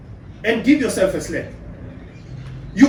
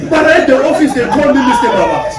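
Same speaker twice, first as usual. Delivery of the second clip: high, loud laughing brag; mock boast; 5 semitones higher, 5 dB louder than usual. Man bragging about respect at the office, loud laughing boast. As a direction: puff up and brag about your title.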